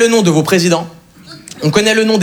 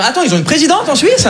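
mock astonishment; 9 semitones higher than usual. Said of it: "vous êtes tous en train de vous dire".

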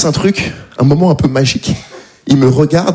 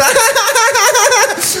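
very high, forced polite giggle; fake courtesy laugh. Comic imitating his polite laugh to a neighbour. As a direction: squeal a fake laugh to seem nice. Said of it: "Vous voyez ce rire-là?".

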